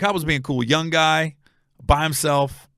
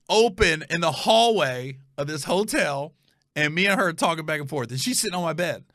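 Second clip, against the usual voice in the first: usual speaking voice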